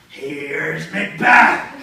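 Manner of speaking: very loud, drawn-out mock-menacing announcement; crazed shout. Macbeth breaks down the king's door, long crazed shout. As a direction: bellow it slowly as you burst in. Axe through door, movie parody.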